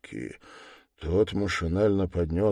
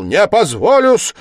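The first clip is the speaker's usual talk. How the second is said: loud, high angry cry; full-force shout; 14 semitones higher, 14 dB louder than usual. A narrator's reading. Suspect refusing to be tormented, shouting furiously. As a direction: shout it with all your might. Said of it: "крикнул он вдруг изо всей силы".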